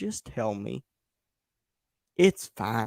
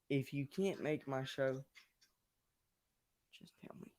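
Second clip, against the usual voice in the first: softly pleading while someone sleeps nearby; hushed; 14 dB quieter than usual. Real, not acted. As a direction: keep it down so she doesn't wake. Wife asleep, keeps it down.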